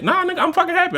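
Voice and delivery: high-pitched happy protest; upbeat, high-voiced denial; emphatically happy. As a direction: insist you're happy, pitch way up. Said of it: "you seem like you upset".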